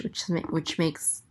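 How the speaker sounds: normal talking voice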